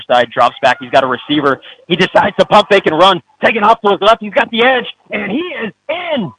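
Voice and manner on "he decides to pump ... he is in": rising excited play-by-play shout; touchdown call. Real, not acted. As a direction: call the touchdown, voice climbing high. Touchdown run call on radio.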